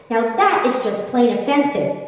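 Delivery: usual speaking voice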